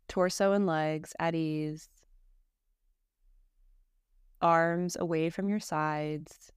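calm, measured meditation voice with long pauses; guided meditation. Acted. Teacher leading a listener through Yoga Nidra practice, guided meditation. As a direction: guide them unhurriedly, settling each phrase into silence.

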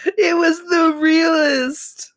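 shouting through laughter; cracking up while shouting it. Real, not acted.

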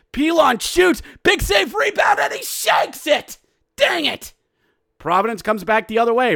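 high, rising hockey call, shouting at the rebound; excited play-by-play. Acted. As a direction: call the rush live, building to a shout.

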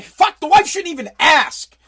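ordinary talk

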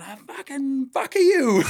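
high furious shriek at the driver; retold scream. Spanish wife yelling at the driver who hit her husband, high shriek. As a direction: scream it in his face, pitch flying up. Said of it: "she's screaming in my face".

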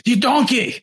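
high-pitched mock outburst with a Scottish-sounding lilt; Gordon Ramsay. Imitating a chef who calls people donkeys, mock-outraged. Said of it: "Gordon Ramsay will call people donkeys".

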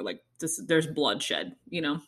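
usual speaking voice

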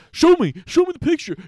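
high, loud, fast, eager begging; eager stalker. Retold or imitated. [name] mimicking Rod begging Natalie for the photo, high and fast. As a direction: beg for the picture like a creepy fan. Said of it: "Rod was like".

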